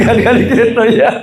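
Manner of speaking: laughs through a quip; laughing. Real, not acted. Preacher to congregation, laughing at his own joke. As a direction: chuckle out the joke. Joke about elite housing guards.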